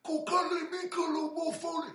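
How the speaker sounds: high, loud yelling call; high shouting call to the other Samoans; imitated yell. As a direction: bellow it out like a big man calling his crew. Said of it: "he yelling out all the other Samoans".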